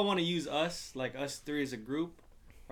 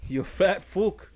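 slow, louder, higher insult; drawn-out mock insult; 4 semitones higher, 6 dB louder, much slower than usual. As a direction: stretch out the insult, pushing it.

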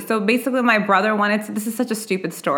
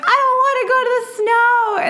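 mock sulky whine. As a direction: whine the refusal like a sulky kid. Sulky refusal in retold fight.

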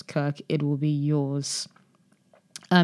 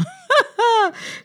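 short high-pitched laugh; 3 dB louder than usual. Real, not acted. Host amused by the mock Google rep pitch, high laugh.

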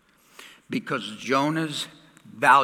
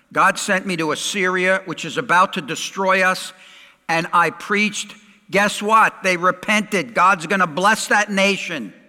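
raised, animated mock report; louder, play-acted bearer of bad news; mock announcement; 5 semitones higher, 5 dB louder than usual. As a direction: break awkward news to your own people. Acts Jonah reporting awkward news home.

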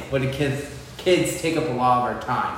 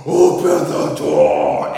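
loud, slow drawn-out call; kid's call; 5 semitones higher, 8 dB louder, much slower than usual. Retold or imitated. Son at bedroom door, loud slow call. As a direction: stretch it out like a child calling. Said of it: "he goes".

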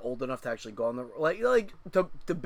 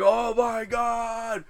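slow, higher drawn-out oh my god; mock panic; 8 semitones higher, 6 dB louder, much slower than usual. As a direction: stretch it out in retold terror. Retold panic on the ride.

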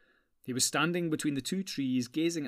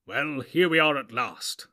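a lightly voiced old wizard, slow and grand; weighty arrival, slowed down; Gandalf's voice; 9 dB louder than usual. A narrator's reading. Wizard to the company at the door, lightly voiced.

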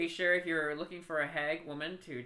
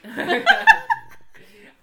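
loud high whooping laugh; burst of laughter; 15 dB louder than usual. Real, not acted. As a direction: crack up at the joke. Big laugh at the joke.

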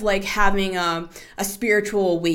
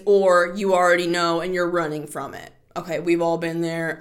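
usual speaking voice

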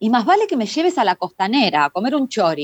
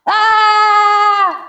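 startled scream; 12 semitones higher, 6 dB louder than usual. Acted.

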